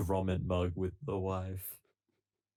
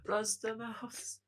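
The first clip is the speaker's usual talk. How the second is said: dreamy call; 11 semitones higher, 9 dB louder, slower than usual. Pretend. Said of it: "He's like".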